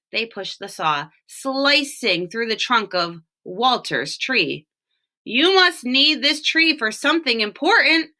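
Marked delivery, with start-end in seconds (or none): 5.2-8.1 called down. Acted. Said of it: "Walter called down".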